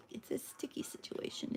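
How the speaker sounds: soft unvoiced whisper; whispered pun. Real, not acted. Joking to viewers while the piece sticks, whispered. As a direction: breathe the pun out softly. Punning while pressing the glue.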